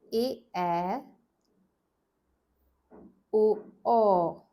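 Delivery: slow, paced vowel pairs; drilled vowel pairs. Shown as a demonstration. Teacher drilling vowel pairs with the class. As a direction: chant each pair for repetition. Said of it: "let's go in this pattern again".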